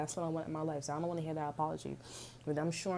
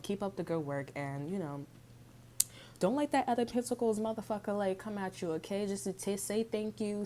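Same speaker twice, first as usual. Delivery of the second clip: ordinary talk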